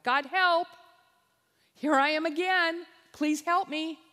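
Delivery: loud, high plea to God; desperate prayer. Acted. Believer calling to God at night, loud desperate plea. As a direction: cry out like a sinner on his face.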